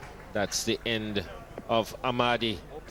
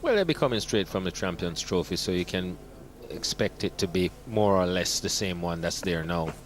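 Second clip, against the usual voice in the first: usual speaking voice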